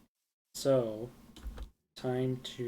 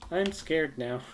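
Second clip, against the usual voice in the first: louder, higher, mock fright; louder scared; 4 semitones higher, 6 dB louder, slower than usual. Real, not acted. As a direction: say it again with louder alarm.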